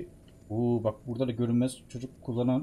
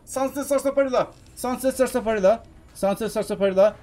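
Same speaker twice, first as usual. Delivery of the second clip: high, loud repeated cheer; worked-up, voice raised, settling slightly; excited; 7 semitones higher, 5 dB louder than usual. Real, not acted. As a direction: call out the drink name with glee. Excitedly chanting drink name mid-fight.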